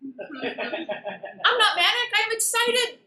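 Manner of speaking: loud, high burst of manic excitement; acted over-the-top excited outburst; manic protest. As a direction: protest loudly, pitch shooting up on excited. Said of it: "anybody who thought she was Jesus Christ".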